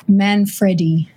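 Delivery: Manfredi with a short e, man-FRED-ee; correct pronunciation of Manfredi. Shown as a demonstration. Guest correcting the host on her surname, short-e Manfredi. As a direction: say Manfredi slowly, middle syllable like "Fred". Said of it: "just a slight correction, it's".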